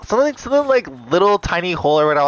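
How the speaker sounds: high, loud and fast; rushed giddy aside. Real, not acted. Vlogger hurrying past the doctor's compliment, giddy. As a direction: blurt it out quickly, embarrassed.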